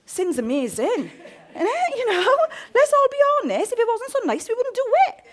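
high, loud teasing preacher; high excited teasing; playful excitement. Real, not acted. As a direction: egg them on with a grin. Preacher teasing congregation about sin.

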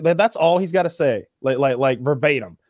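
ordinary talk

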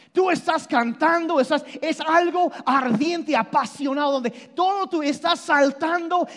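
usual speaking voice

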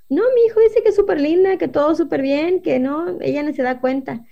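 airy dismissive chat-group mom; other mom. Retold or imitated. Another mom brushes off the complaint, breezy and high.

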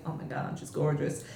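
hushed, awestruck, under the breath; breathless awe. Retold or imitated. Nick gazing at Abby in costume, hushed awe. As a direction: gasp it like you are smitten.

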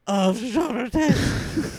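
high, loud mock delight; gleeful cry, slowed; excited reaction. Retelling the show crowd's gleeful reaction, high and loud.

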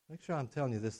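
ordinary talk

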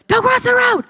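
put-on sharp high warning yell at a child; high, loud parent's shout of alarm. Parent yelling at child near road.

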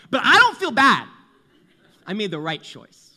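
high, loud mock-defensive rant; excited retelling. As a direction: gush loudly about the promised land of pizza. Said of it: "And then he was like".

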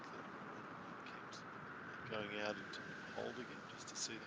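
faint, far from the microphone; drifting away from the mic. Real, not acted. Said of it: "couldn't hear when you were off mic".

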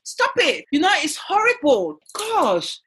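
high-pitched, appalled complaint; comic indignant rant; exasperated outrage. Real, not acted. Mock rant at the accent.

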